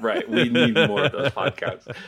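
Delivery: amused, said through laughter; laughing. Real, not acted. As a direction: repeat it while laughing.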